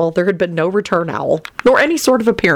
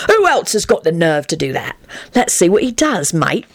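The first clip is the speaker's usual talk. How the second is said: ordinary delivery, nothing marked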